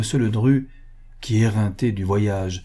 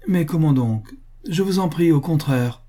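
higher-pitched, eager insistence; lively, gushing politeness; delighted exclamation; 7 semitones higher than usual. A narrator's reading. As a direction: exclaim it, only too glad to oblige. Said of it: "s'écria madame Moret, ravie".

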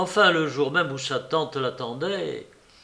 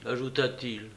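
ordinary delivery

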